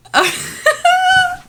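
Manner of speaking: a high burst of giddy laughter. Real, not acted. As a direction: laugh out loud, delighted. Giddy after finishing book.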